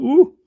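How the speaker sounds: retold "ooh". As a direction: let out an impressed, high "ooh". Retold reaction on spotting find.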